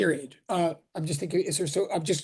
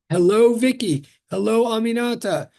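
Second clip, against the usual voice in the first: ordinary talk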